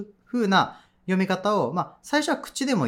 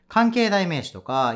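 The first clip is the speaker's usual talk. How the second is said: usual speaking voice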